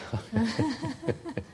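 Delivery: short burst of laughter. Real, not acted. Teacher joking to students about paid teachings, laughing. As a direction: laugh at your own joke.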